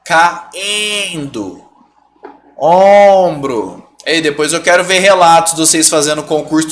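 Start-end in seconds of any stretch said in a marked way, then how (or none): 0.0-3.9 ENDO and OMBRO stretched on nasal vowels; slow syllables, long nasal vowel in OMBRO. Shown as a demonstration. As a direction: draw out OMBRO through the nose. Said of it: "trazer esse I pro nariz".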